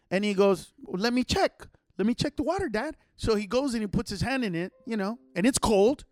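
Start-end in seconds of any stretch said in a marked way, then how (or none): 0.8-2.9 lightly voiced small child; higher voice, imitating a young son; hint of an eager toddler; little kid. Imitating his three-year-old son.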